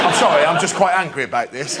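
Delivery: ordinary delivery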